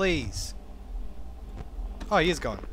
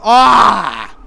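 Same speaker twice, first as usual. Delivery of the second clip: loud sudden yelp; startled scream; 11 dB louder than usual. Real, not acted. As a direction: yelp in fright. Jump scare.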